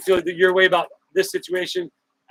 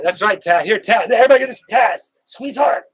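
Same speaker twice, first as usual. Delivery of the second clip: rising, louder and higher hug cheer; excited hug call; 5 semitones higher, 8 dB louder than usual. Real, not acted. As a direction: cheer everyone into a big squeeze. Sending a virtual hug loudly.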